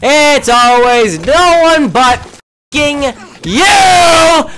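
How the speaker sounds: furious high shout; screaming. Real, not acted. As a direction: scream it at them. Rant peaks into screaming.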